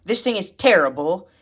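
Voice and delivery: usual speaking voice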